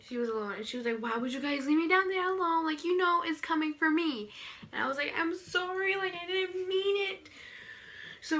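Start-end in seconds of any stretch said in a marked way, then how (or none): 1.0-4.3 high-pitched retold protest from a scared friend; upset complaint. Scared friend to those who left her alone, upset protest. 5.2-7.3 high-pitched mock-pleading sorry; pleading apology. To the friend left downstairs alone, high pleading apology.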